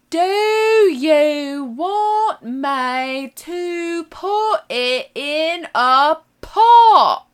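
loud, high, very slow word-by-word shout; hugely drawn-out mock Cumbrian clerk; exaggerated slowness. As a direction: bellow each word slowly as if to someone who can't understand. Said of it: "if she said it slower".

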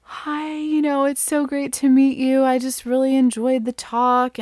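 a mock starstruck fan; gushing fan voice. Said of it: "And I'm like".

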